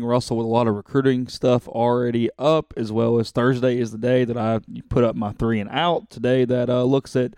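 ordinary talk

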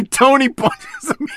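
blurting it through laughter; laughing. Real, not acted. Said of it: "I just had to laugh".

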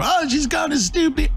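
squeaky mocking chat gossip; high mocking falsetto.

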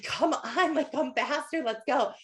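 husband urging. Retold or imitated. Husband on the trail urging his tired wife upward, lightly mock impatient. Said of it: "He's like".

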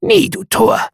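very high, loud bird cry; raven's refrain. A narrator's reading. Raven refuses the narrator's plea, screeching high. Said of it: "Sprach der Rabe".

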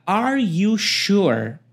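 very slow "Are you sure?" with rising tune; slow teaching demo; slow question. Shown as a demonstration. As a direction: stretch out the question slowly. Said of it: "o A pergunta".

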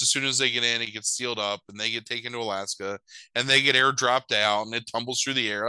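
usual speaking voice

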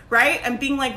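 usual speaking voice

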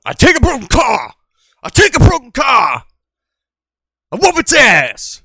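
high, loud hammed-up boast; tough-guy shout. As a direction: bellow it like a wrestler promo.

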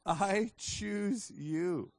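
I choose you, each word slow and weighty; slow declaration. Retold or imitated. Preacher declaring I choose you slowly to the congregation. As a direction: stretch out I choose you like a vow. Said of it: "I was like".

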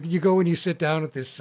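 ordinary delivery

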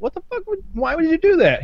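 high-pitched stunned outburst; shocked disbelief. Retold or imitated. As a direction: react like you can't believe what he did. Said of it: "everybody was like".

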